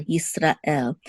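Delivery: Yisrael with a break after Yisra; two separate words. Shown as a demonstration. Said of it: "as if it's two separate words".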